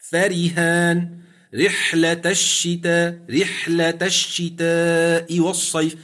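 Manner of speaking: فريهان رحلة الشتاء recited slowly, with a thin ر; Quranic recitation. Shown as a demonstration. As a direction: chant the verse slowly. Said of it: "كما في قوله مثلًا فريهان".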